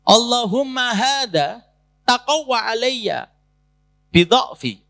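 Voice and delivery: slow, chanted Arabic supplication; prayer recitation. Acted. Preacher recites the wronged fisherman's prayer, slow chanted supplication. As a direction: intone the prayer slowly and earnestly.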